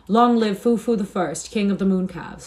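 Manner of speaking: acted mob shout, stretched and projected; roaring shout. A narrator's reading. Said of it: "a roaring shout went up".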